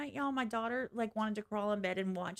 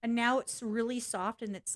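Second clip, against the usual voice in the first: ordinary delivery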